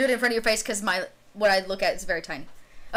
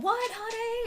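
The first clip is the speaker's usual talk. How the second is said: higher mother calling away from the phone; mother calling aside; 12 semitones higher, 3 dB quieter, slower than usual. Acted. Mother covers the phone and answers her husband, higher. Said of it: "cover the phone, to your dad".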